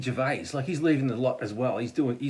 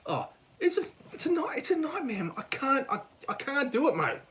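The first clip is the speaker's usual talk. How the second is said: high-pitched, overwrought complaint; mock distress; 10 semitones higher than usual. Telling a mate it's a nightmare, mock distressed. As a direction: act out a high, helpless protest.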